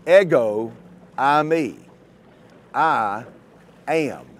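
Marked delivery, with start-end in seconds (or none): 0.1-1.8 "ego eimi" said slowly in Greek, each word set apart. Shown as a demonstration. Preacher reading Greek to his flock, slow and careful. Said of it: "In the Greek, those words are".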